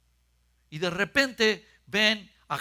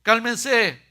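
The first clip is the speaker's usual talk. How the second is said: louder, higher calming call; Jesus reassuring; 7 semitones higher, 8 dB louder, much slower than usual. Retold or imitated. Jesus calls to the frightened disciples, louder. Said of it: "Jesús le dice".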